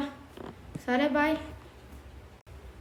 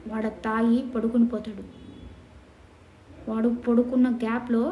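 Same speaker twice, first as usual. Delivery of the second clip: ordinary delivery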